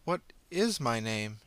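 drawn-out, stressing is; slow, thoughtful repeat; slow musing. Acted. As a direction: ponder it aloud slowly. Girl ponders.

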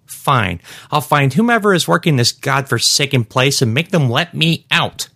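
high-pitched, huffy woman's voice; indignant shopper. Acted. Trapped shopper vowing to find mall staff, indignant.

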